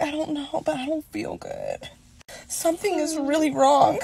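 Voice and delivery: faint, breathy little voice playing sick; weak sick whisper.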